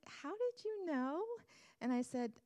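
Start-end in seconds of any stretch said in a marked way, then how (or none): 0.1-1.2 surprised. Retold or imitated. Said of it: "he's like".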